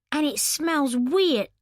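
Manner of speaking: slow, high whine of disgust; disgusted Lauren. Acted. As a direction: turn your nose up at the smell. Lauren wrinkles nose at drink.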